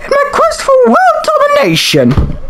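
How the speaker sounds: very high, scheming computer queen. Acted. Computer queen declaring her quest, high grandiose villain voice. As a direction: announce a villain's plan in a high regal voice. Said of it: "I'll give her a voice".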